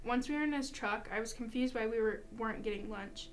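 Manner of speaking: usual speaking voice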